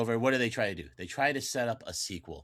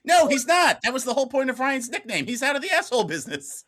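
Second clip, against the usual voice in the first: higher, louder, insisting he is redeemed; heated protest; 12 semitones higher, 9 dB louder than usual. Real, not acted. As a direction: push back hard and loud, voice climbing. Heated argument about the film.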